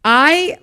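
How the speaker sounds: high squeal of a laugh; laughter. Real, not acted. After the suck in pun, a high laughing squeal. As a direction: laugh at your own pun.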